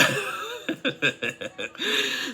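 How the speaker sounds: high delighted squeal of a laugh. Real, not acted. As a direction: let out a gleeful squeal.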